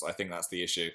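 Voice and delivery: ordinary delivery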